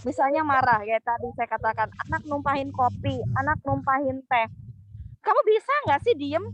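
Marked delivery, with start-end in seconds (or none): 5.2-6.4 angry snap. Shown as a demonstration. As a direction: snap at the child who spilled coffee. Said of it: "Enggak, itu marah".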